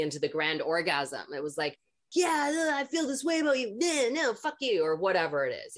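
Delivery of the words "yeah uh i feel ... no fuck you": high, loud mock outburst; hammed-up gushing turning to rejection; mocking ex-lovers. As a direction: imitate flaky lovers in an exaggerated high voice. Mimics men coming on strong, then quitting.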